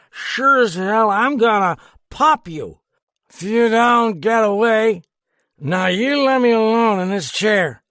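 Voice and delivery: high, loud slurred menace; drunken threat. A narrator's reading. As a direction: warn him off, boozily.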